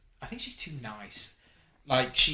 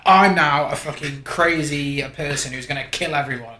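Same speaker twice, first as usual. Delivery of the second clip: loud, higher, hammed-up proclamation; mock crazed declaration; 4 semitones higher, 15 dB louder than usual. Fan mocking Khaleesi's sudden turn, loud mock declaration. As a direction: belt it out as a mocking villain announcement.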